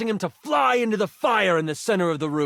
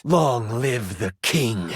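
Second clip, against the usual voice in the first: defiant prince; 6 semitones lower, slower than usual. Acted. Prince defiantly speaks to his father.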